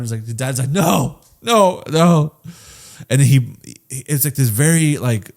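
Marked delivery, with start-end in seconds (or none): 0.7-2.3 urgent protest, rising. Retold or imitated. Quoting the dad protesting.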